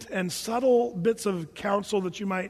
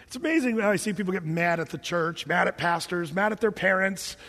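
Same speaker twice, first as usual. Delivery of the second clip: usual speaking voice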